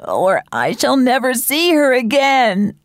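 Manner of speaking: slow, drawn-out feverish lament; desperate cry. A narrator's reading. Sick sister afraid of dying without her mother, desperate. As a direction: wail it slowly, pleading from a sickbed. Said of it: "Mary Ann cried desperately".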